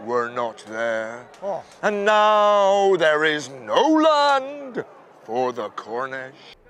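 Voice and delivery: slow, low, grave lament; a deep, slow, grieving divine voice; sorrowful God. Acted. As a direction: mourn over wayward children like a deep old god. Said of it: "says God".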